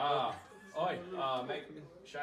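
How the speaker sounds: halting mock Cockney address; a tentative Cockney accent. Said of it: "I'm a little cockney".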